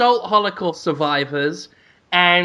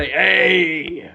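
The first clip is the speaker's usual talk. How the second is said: lightly voiced TV detective catchphrase; Telly Savalas; much slower than usual. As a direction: draw the catchphrase out slowly in a put-on voice. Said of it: "S-, Telly Savalas".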